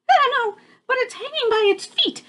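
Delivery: very high, quick chirpy little bird voice; chirpy baby bird. Acted. Baby bird chirping to siblings about the strange bat. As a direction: chirp it out fast and curious. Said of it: "chirped Flitter".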